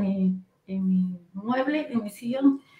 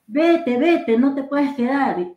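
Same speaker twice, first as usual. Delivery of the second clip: high, loud cry to get out; acted shout, raised in pitch and volume; retold shouting; 6 semitones higher, 4 dB louder, slower than usual. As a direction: yell to drive an intruder away. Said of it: "Y yo comencé a gritar".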